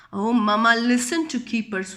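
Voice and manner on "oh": plain reading voice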